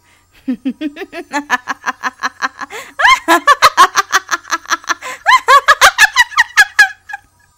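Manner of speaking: long, very high and loud evil cackle; maniacal cackle. Acted. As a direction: cackle in triumph. Villain's triumphant laugh.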